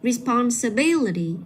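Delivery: responsibility said slowly with BIL stressed; stress before the ending. Shown as a demonstration. Teacher modelling for a student, responsibility slow, stressed on BIL. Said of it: "Nhấn vào".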